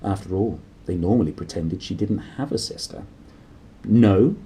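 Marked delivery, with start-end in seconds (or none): 3.8-4.4 a sharp, loud "No"; said sharply. Acted. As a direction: snap it out, clearly louder. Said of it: "she said sharply".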